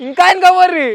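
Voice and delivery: high, loud shout to friends far across the field; calling out far. Real, not acted. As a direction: yell out so they hear from far off.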